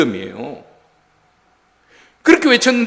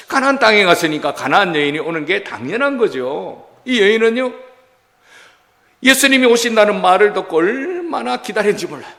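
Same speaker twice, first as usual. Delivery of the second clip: ordinary talk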